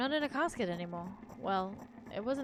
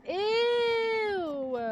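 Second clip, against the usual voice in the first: long, high, loud wail; pained scream; 12 semitones higher, 8 dB louder than usual. A narrator's reading. Narrator touches the mushy, scratched face and lets out a long high scream. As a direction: scream in agony and hold it. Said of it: "I scream and yank my hands away".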